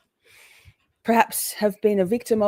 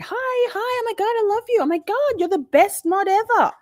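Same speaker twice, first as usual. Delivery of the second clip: much higher, gushing fake-sweet voice; sickly sweet; 13 semitones higher than usual. Mimicking chatters fawning over a mod, sickly sweet. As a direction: lay the fake sweetness on thick. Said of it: "sickly sweet, saccharine sweet".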